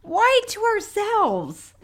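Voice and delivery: high, slow puzzled question; mock baffled. Co-host voicing the confused killer, high and slow. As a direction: drag it out in disbelief.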